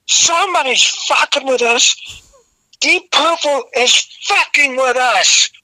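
high, slowing mock rant; retold yell. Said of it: "And I'm yelling".

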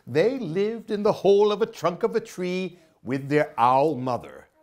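usual speaking voice

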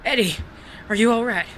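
alarmed shout. Acted. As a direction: rush to the wounded man calling out. Finds wounded Eddie after gunfight.

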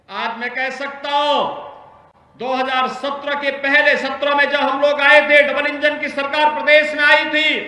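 ordinary talk, nothing marked

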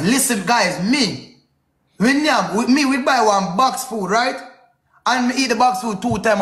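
ordinary delivery

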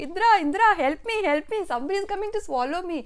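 crying out loud for help, high and urgent; acted alarm, opening loud then rushing; Surya Deva. A narrator's reading. Surya Deva calls up to Indra in the heavens, crying out for help. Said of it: "cry out loud for his help".

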